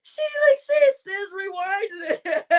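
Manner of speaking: high, laugh-cracked voice, slow on the quote; laughing retell. Real, not acted. Streamer retelling sis's words, high laughing squeal. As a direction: squeal it through giggles.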